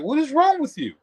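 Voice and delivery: high, sharp voicing of the wife asking what's wrong; mimicking his wife. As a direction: play the wife, puzzled and annoyed. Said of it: "she up there like".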